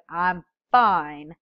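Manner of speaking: arms-crossed, not-really-fine tone; defensive fine. Acted. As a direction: insist you're fine while clearly upset. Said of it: "you say it in a way that says".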